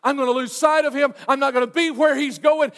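loud, high, urgent preacher; impassioned preaching. Real, not acted. Preacher warning the congregation what they could miss, impassioned.

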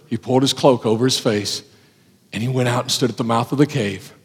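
usual speaking voice

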